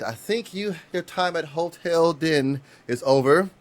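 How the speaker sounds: high, mock-formal dismissal; mock-polite eviction. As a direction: show him out like a hotel clerk. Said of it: "And I said".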